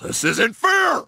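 high, drawn-out indignant shout; whining protest. Acted. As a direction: cry out at the unfairness. Refused the case, protests.

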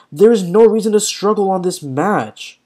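ordinary talk

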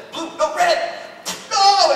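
high blurt rising to a yell; change your answer and cry out in panic; panicked waffling. Acted. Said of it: "he kinda waffles a little bit".

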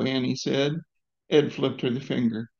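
ordinary delivery

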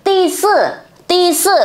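Ti sư said high, twice; Chinese pronunciation demo. Shown as a demonstration. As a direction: model Ti sư clearly for students. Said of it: "mình sẽ nói là gì nhỉ".

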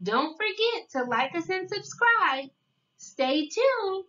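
usual speaking voice

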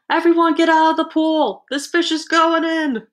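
high, loud sustained shout; bellowed storybook announcement; yelled warning. A narrator's reading. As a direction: clear everyone out with a big yell. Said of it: "The firemen yelled".

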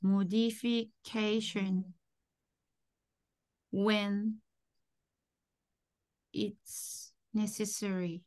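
very slow, word by word; slow teacher's model with pauses; slow modelling. Shown as a demonstration. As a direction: dictate it to a learner, long gaps between words. Teacher models the phrase for learner.

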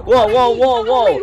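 loud, high cries of alarm; real panicked yell. Real, not acted. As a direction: yell out in sudden fright. Sudden scare in horror game.

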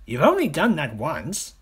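high, loud little-croc scoff; dismissive snort. A narrator's reading. Smaller crocodile deflating the big one's brag, high and scornful. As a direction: puncture the boast scornfully. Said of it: "snorted the not so big one".

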